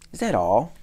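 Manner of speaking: loud, let-down question; loud and unimpressed; disappointed cry. A narrator's reading. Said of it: "cried the town mouse, looking disappointed".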